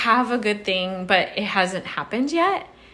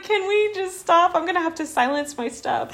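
exasperated plea; 11 semitones higher than usual. Retold or imitated. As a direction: beg the feed to stop. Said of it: "I'm like".

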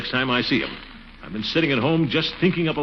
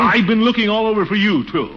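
indignant echo; 6 semitones higher than usual. Acted. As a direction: fire his own line right back at him. Angry man echoes the mayor.

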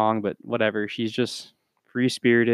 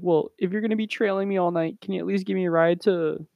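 high-pitched quoted request; mock girl voice asking a favour; imitating the teenage girl; 8 semitones higher, faster than usual. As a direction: pitch it well up as a put-on girl. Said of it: "And then she's like".

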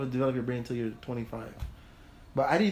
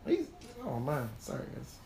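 ordinary talk, nothing marked

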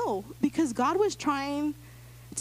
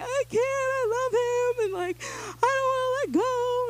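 tearful pleading; 13 semitones higher than usual. Retold or imitated. Telling the church how she cried over leaving the guy, tearful. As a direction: cry it out like you can't let go. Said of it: "I'm crying because".

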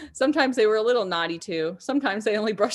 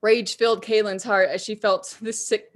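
ordinary delivery

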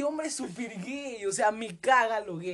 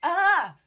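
startled yell; 7 semitones higher, 9 dB louder than usual. Real, not acted. Startled scream, laughing.

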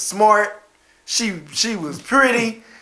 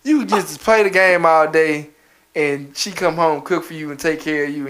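ordinary talk

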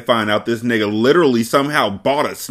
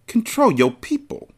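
"Control your people" pitched much higher, rising, mocking; mock imitation. Reader mocking Monroe's line, high and sarcastic. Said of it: "I kicked his ass down the stairs".

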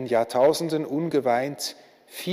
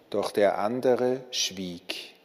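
ordinary delivery, nothing marked

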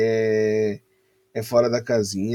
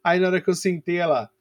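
ordinary talk, nothing marked

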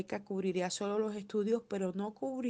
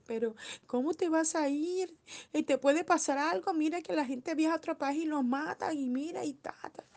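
mock anxious parents, much higher; worried parents; 7 semitones higher than usual. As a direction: fret like a mother scaring her son. Parents fearing son's trip abroad. (padres preocupados imitados, voz mucho más aguda)

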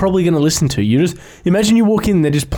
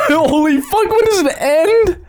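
mock panic; 12 semitones higher than usual. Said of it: "You're like".

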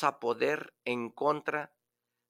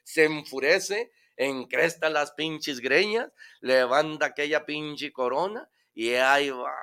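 normal talking voice

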